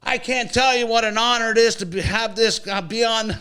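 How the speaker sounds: mocking an overeager athlete speech; mock gushing speech. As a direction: ham up a humble star on the mic. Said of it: "He grabs the mic and he goes".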